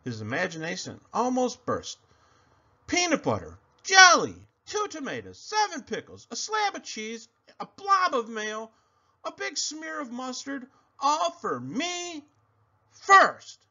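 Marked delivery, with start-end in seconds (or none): 2.9-13.6 excited listing. Acted. Pig dreams of sandwich.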